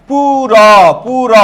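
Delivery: "পুর" stretched as puu-rooo; drawn-out syllables. Shown as a demonstration. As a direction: break "পুর" into two long syllables, holding the final vowel. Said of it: "সবাই একটু লক্ষ্য করো".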